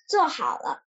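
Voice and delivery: plain reading voice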